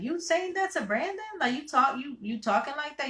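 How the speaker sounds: high, incredulous questioning; friend checking her. Retold or imitated. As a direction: imitate a friend calling you out in disbelief. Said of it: "she checked me like".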